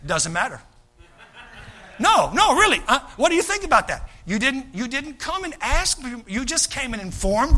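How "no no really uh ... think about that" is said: higher, laughing insistent ask; mock visitor pressing the pastor; pushy church member. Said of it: "come in to sit down with me and say".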